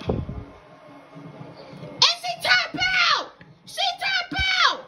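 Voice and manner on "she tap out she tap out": high shouted call of the finish; excited shout. Real, not acted. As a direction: yell out the tap-out. Calls the finish.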